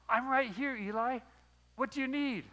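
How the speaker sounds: put-on boy bursting in ready to serve; eager. Young Samuel running to Eli at night, eager.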